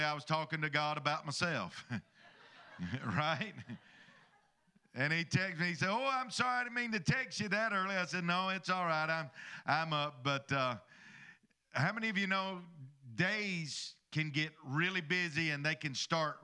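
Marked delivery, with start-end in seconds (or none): none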